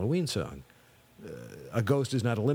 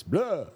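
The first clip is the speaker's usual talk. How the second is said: loud high vampire cry "Blah!"; 11 semitones higher, 5 dB louder than usual. Shown as a demonstration. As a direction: hiss a theatrical Dracula "Blah!". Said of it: "Lugosi era vampire voice".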